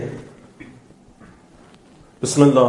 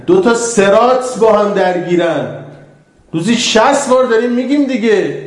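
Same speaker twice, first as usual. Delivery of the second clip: louder, higher pressing declaration; building sermon climax; 6 semitones higher, 4 dB louder than usual. Acted. Preacher insisting to the audience on two paths, rising and loud. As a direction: hammer the two paths home.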